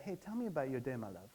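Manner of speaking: really want to hear about their day; a soft, loving ask to a spouse; tender open question. Pretend. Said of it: "you would say".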